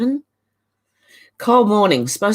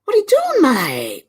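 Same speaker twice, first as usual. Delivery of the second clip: excited; 10 semitones higher, 3 dB louder than usual. Pretend. Playful exaggerated mate.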